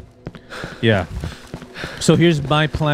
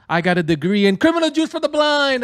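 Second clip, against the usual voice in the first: much higher joking announcer voice; mock announcement; 13 semitones higher than usual. Gamer jokes about his fake degree. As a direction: pitch it up like a silly boast.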